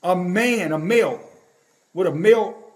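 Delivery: "man" and "male" punched out distinctly; firm stress on each "male"; clear emphasis. Real, not acted. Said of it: "Let me say that clearly".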